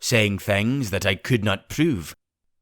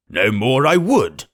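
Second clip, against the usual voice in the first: louder, slowed huffy exclamation; indignant cry; 4 semitones higher, 6 dB louder, slower than usual. Acted. Squire offended at being kept out, louder indignant cry. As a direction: bristle with wounded pride. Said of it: "cried the squire".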